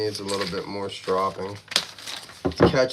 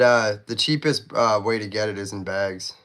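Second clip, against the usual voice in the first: normal talking voice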